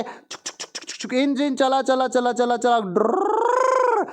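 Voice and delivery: whispered chug-chug rising to a high engine whoop; train noises. Pretend. Boy playing with his toy train, chugging like an engine. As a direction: play a toy train, chugging then whooshing.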